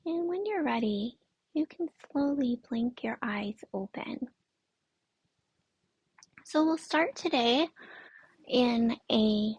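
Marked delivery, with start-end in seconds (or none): 0.1-4.3 guided meditation. Acted. Yoga teacher centering the class on their breath, guided meditation. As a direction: guide them gently inward, unhurried and soothing.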